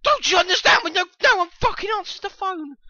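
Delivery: high, loud angry yell; real exasperated shouting; pissed off. Real, not acted. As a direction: rant at the top of your voice. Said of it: "I'm getting pissed off now".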